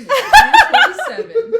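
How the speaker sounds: high, loud laughing droid voice. Retold or imitated. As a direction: squeal the droid line through laughter. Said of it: "two twenty-seven would say".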